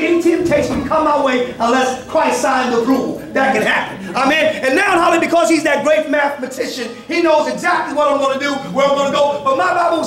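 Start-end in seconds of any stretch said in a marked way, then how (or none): none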